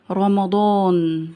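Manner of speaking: very slow, held final syllable; drawn-out dictation. Shown as a demonstration. Teacher listing tricky example words, drawn out. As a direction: stretch the word out for the class.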